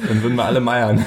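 words through a laugh; laughing while talking. Real, not acted. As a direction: giggle through the line. Laughing at own joke.

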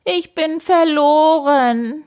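high, slow, drawn-out wail; wailing cry. A narrator's reading. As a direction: howl it like a defeated dragon. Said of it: "schrie er und heulte". (laut klagend geheult)

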